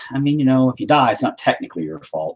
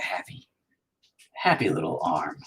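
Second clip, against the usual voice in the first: hushed, gentle painter impression; mock-tender TV painter, trailing into near whisper; Bob Ross; 3 semitones lower, 4 dB quieter than usual. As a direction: drop into a soft, soothing painting-show murmur. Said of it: "Fuck you, Bob Ross".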